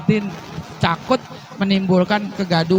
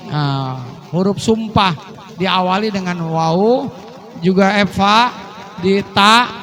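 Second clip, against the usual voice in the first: usual speaking voice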